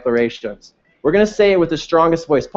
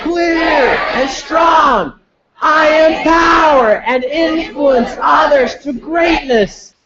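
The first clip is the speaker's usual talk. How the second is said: slow, high full-force shout; strongest voice declaration; 10 semitones higher, slower than usual. Shown as a demonstration. Leading the room's declarations, a slow high full shout. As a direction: give it all you got. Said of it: "I wanna hear you loud".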